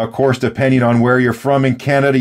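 normal talking voice

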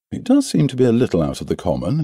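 ordinary delivery

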